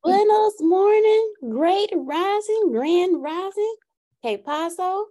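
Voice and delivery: high, slow, stretched Southern twang on Spanish; hammed-up Southern drawl greeting in bad Spanish; horrible Southern-accented Spanish. Guest greeting everyone after her introduction, mock Southern accent in Spanish. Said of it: "That was a horrible Southern accent in Spanish".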